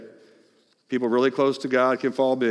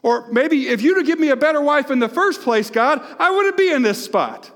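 blaming God; 11 semitones higher, 4 dB louder than usual. Retold or imitated. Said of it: "Maybe even blame God a little bit".

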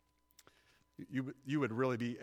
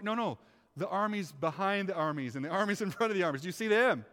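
high, loud, excited insistence; insistent prophet; 7 semitones higher, 7 dB louder than usual. Acted. Prophet pointing out hidden armies to servant, excitedly insistent. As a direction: build to an urgent loud question.

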